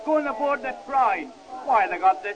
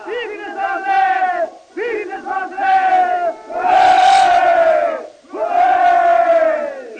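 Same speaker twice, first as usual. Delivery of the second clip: loud cheering shout, slow; 5 semitones higher, 7 dB louder, much slower than usual. Real, not acted. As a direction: cheer them on at full voice. Crowd cheering the troops.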